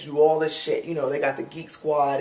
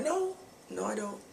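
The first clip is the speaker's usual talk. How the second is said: mock innocent; 5 semitones higher, 10 dB quieter than usual. Answering credit card question.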